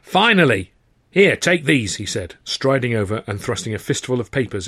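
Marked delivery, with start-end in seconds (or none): 0.0-2.0 raised, higher harassed man; impatient outburst from an overworked boss; losing his temper. A narrator's reading. Harassed manager thrusts papers at a stranger, losing his temper. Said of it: "losing his hair and his temper".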